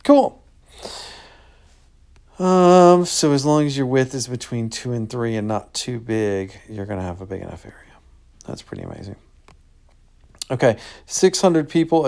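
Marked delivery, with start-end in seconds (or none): 0.0-0.4 delighted exclaim. Real, not acted. Pleased with result. 6.7-9.2 fast whispered aside; hurried hushed conclusion; quick whisper. Real, not acted.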